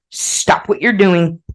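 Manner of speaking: normal talking voice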